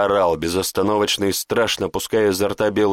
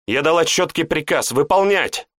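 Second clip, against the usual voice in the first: firm raised female order; stern command; 7 semitones higher than usual. A narrator's reading. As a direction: insist the order stands. Woman insists on order.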